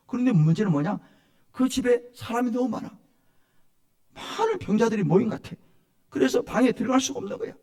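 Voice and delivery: usual speaking voice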